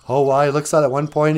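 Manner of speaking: ordinary delivery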